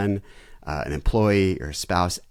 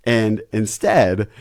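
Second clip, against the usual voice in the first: normal talking voice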